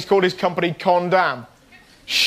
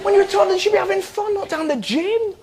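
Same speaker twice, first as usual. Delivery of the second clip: much higher, insistent; high-pitched protest; 10 semitones higher than usual. Real, not acted. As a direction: plead it up high. Emphatic protest about toddlers.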